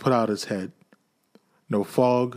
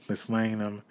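plain reading voice

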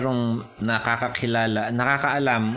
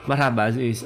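ordinary talk

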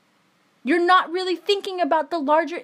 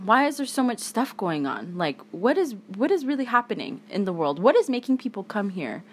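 lower, softened pondering voice; thinking it through; 5 semitones lower, 4 dB quieter than usual. Acted. Imagined critical thinker wondering why migrants come here, thoughtful musing. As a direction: muse aloud, as if reasoning it out. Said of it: "really actually thought about this shit, we would say".

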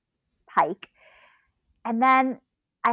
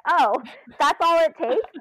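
amused realization; 8 semitones higher, 6 dB louder than usual. Retold or imitated. Realization recalled with laughter.

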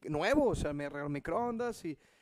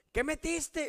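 higher accusing question; mock angry; 9 semitones higher, much slower than usual. Wife interrogating him about the microwave, mock angry. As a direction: imitate the angry wife, lightly. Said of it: "bien enojada".

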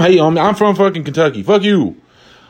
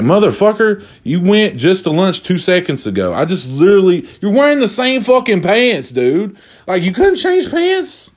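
high, exasperated coworker; calling out his buddy, voice climbing; 5 semitones higher than usual. Acted.

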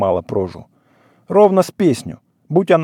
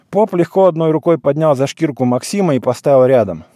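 ordinary delivery

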